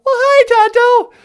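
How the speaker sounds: the lady greeting the caller brightly; high delighted lady voice. Pretend. Natasha greets Tonto.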